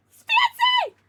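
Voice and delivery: squealed the name loud and very high; a delighted shriek of greeting; excited shout. Real, not acted. Greeting a chat friend excitedly.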